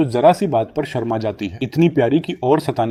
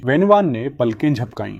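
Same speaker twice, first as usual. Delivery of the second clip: ordinary delivery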